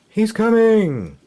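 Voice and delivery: high, loud, slow urgent call; panicked villager crying out; alarmed cry. Acted. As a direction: shout the warning.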